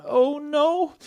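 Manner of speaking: very high, loud dismay; high-pitched squeak of alarm; alarmed Stella. Acted. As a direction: squeal it in a small character voice. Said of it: "And so Stella thought".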